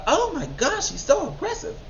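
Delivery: high, breathy put-on gasp, far above her own voice. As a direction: clutch your pearls and act scandalised. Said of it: "And they'll be like".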